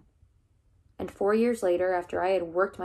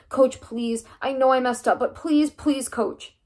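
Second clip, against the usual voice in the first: louder, pitched-up desperate begging; kneeling before the coach, pleading with a breaking voice; 5 semitones higher, 6 dB louder than usual. Acted. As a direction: beg on your knees, voice cracking. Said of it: "The words began to break in my throat".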